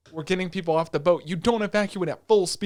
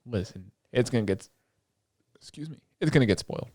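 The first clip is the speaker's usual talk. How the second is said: whisper; 8 semitones lower, 6 dB quieter than usual. Real, not acted. Warns audience about spoilers.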